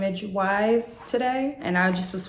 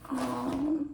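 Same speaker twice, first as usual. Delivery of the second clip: high, soft voice through tears; overwhelmed, crying softly; 7 semitones higher, 4 dB quieter, slower than usual. Real, not acted. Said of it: "I burst out crying".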